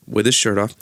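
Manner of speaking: ordinary delivery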